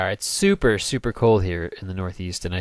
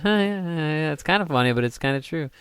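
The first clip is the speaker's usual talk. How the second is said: lightly voiced wife, higher pitch; offhand imitated wife; 6 semitones higher than usual. Husband imitating his wife's reaction, mildly amused agreement. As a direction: mildly mimic her higher, noncommittal agreement. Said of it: "She just kinda went".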